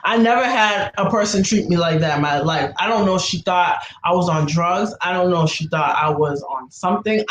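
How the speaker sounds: ordinary delivery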